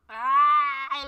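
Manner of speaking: a loud, drawn-out frustrated yell. Real, not acted. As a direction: let out the stress in one shout. Frustrated outburst while searching.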